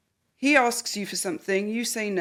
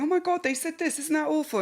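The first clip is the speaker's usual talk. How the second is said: higher, breathless mock indignation; gossiping outrage; 6 semitones higher than usual. Person repeating a hurtful remark to a friend, mock scandalised. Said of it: "repeat it to someone else going".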